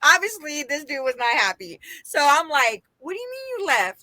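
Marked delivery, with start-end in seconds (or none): 3.0-4.0 incredulous. Retold or imitated. Confronting date on phone.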